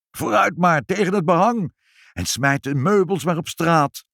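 high-pitched, excited orders; urgent commands. A narrator's reading. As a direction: urge the firefighters on. Shouting orders at the fire. (opgewonden, hoge bevelen)

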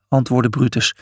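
ordinary delivery

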